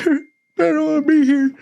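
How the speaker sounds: mock whimpering in a high voice; squeaky mocking whine of a homesick boy; whiny crying recruit. Mocking the sobbing bunkmate in a high whine.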